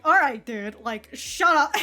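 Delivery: loud, exasperated snap; mock shout. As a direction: cut him off, fed up. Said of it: "it's just like".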